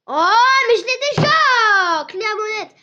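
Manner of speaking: high, loud, drawn-out outcry of dismay; slow, shocked yell of disappointment; dismayed shout. Real, not acted. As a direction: wail that you already have it. Finds a duplicate gift in game.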